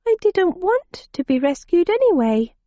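put-on high girlish voice; young girl voice. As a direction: play a stubborn young girl.

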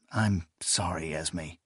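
soft, low, vague apology; subdued apology fading on the name. A narrator's reading. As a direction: trail off low and quiet, half absent. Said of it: "he said vaguely".

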